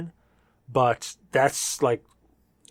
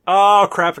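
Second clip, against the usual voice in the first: loud, high exclamation; surprised; 7 semitones higher, 9 dB louder than usual. Real, not acted. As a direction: caught out, blurt it. Caught by the drinking rule.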